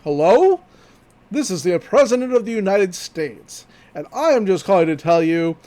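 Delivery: loud, pompous prank-call voice; mock presidential announcement; prank caller. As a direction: put on a big official phone voice. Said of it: "think of the prank calls".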